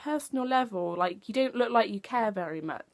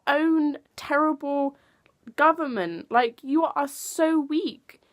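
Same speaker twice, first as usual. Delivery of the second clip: loud, slow, exasperated; higher, louder venting; frustrated rant; 4 semitones higher, 5 dB louder than usual. Real, not acted. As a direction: let the anger out slowly. Ranting at government.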